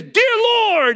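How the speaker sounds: very high, loud imitated Australian prayer cry; Australian accent. Doctor popping up beside the couple to pray, loud mock Australian accent. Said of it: "in his Australian accent".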